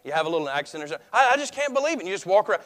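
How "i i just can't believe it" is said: much higher, louder frantic sputter; rising disbelief. Retold or imitated. Angry person pacing around, frantic disbelief. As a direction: stammer it out, more worked up. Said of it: "keep saying the same thing".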